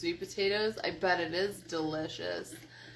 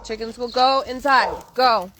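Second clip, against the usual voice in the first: loud command to the dog; shouting; 4 semitones higher, 9 dB louder than usual. Real, not acted. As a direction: order the dog in loudly.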